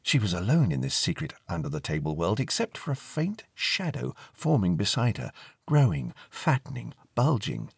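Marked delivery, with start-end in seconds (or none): none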